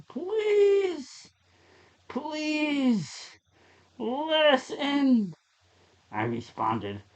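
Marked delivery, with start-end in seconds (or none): 0.1-5.3 much higher, slow, begging voice; desperate pleading. Acted. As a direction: plead as if your life depends on it. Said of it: "Then in desperation".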